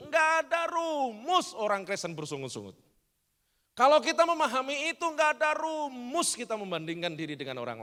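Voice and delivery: loud, rhythmic repeated refrain; preaching at full force. Real, not acted. Preacher hammering a refrain to the church. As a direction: drive each line home.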